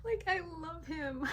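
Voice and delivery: high, laughing swoon; squealing high with delight; gushing. Real, not acted. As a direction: melt with fondness. Fond gush between laughs.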